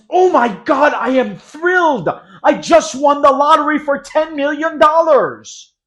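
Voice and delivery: very high, loud thrilled cry. Shown as a demonstration. Teacher acting a lottery winner, loud thrilled shout. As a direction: shout it out like you just won big. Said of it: "Thrilled is".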